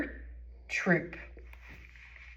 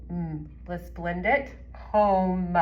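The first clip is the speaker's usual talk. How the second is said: ordinary talk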